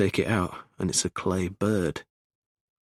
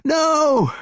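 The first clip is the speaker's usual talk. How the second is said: high, drawn-out cry of protest; desperate shout; 7 dB louder than usual. A narrator's reading. Wife sees the gun about to fire, desperate shout. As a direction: shout it out to stop the shot. Essie cries out before the shot.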